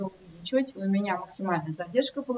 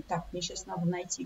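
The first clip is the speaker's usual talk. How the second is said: normal talking voice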